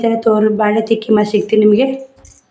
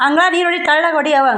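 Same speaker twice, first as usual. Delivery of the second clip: calling out instructions; 6 semitones higher than usual. Real, not acted. Telling a girl to sprinkle water in the yard, calling out. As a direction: raise the pitch to direct someone across the yard.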